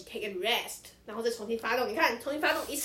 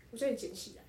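usual speaking voice

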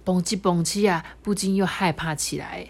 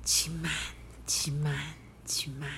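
softer and softer, fading away; chugging softly; 3 semitones lower, 10 dB quieter than usual. Acted. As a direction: let each one fade. Train chugging sound.

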